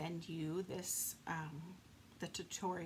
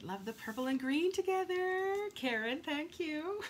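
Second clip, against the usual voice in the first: higher, louder gushing; excited thanks; 9 semitones higher, 6 dB louder than usual. Real, not acted. Crafter thanking viewer Karen on the livestream, excited. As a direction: beam at the compliment. Reading viewer comments aloud.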